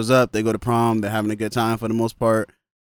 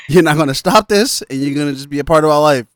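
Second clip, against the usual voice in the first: louder, rising, half-laughing defiance; defiant outburst; 4 semitones higher, 9 dB louder than usual. Retold or imitated. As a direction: throw it at mom like a challenge. Carrie defiantly quoted against mom.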